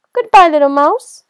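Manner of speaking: high, very loud farewell with a rising end; alarmed goodbye. Acted. Owl fleeing the mouse, loud alarmed farewell. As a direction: call out a nervous goodbye. Frightened owl takes hasty leave.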